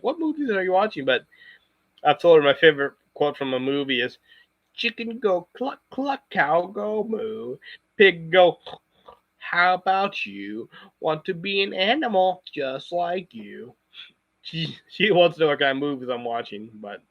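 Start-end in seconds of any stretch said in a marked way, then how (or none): 0.0-1.1 high-pitched mock bewilderment; imitated puzzled boss. Regional director asking about movies, imitated puzzlement. 4.7-13.7 high, slow, drawn-out comic character voice; movie quote voice. Acted. Streamer reciting favorite Kung Pao quote, high slow comic voice.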